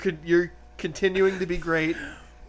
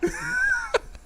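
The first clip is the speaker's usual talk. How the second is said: triumphant shriek laugh; 3 dB quieter than usual. Real, not acted. Grabbing the ball.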